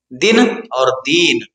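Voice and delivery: slow demo contrasting दिन with a short i and दीन with a long ee; short and long vowel. Shown as a demonstration. Teacher showing students the difference, slow careful demo. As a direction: draw out the ee in दीन, keep the i in दिन clipped. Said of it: "फर्क है".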